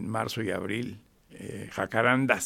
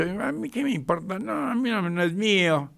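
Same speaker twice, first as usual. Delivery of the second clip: much higher dismissive voice; acted careless retort; 8 semitones higher than usual. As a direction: toss it off like it's not your problem. Acts out careless vandal replying.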